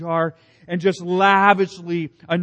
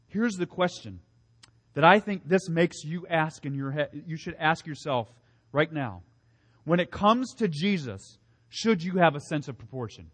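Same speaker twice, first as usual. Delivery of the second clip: normal talking voice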